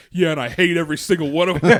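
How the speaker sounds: loud, high mock outburst; brother's outburst. Host imitates his brother answering dad at school, loud hateful blurt. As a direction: blurt it out at full volume, imitating a kid.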